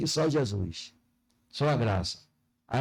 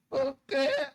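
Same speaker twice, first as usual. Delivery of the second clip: high, indignant mock retort; snippy reply; 10 semitones higher than usual. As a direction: act out a rude church member snapping back. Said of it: "ele fala assim, ó". (réplica atrevida e indignada, voz aguda)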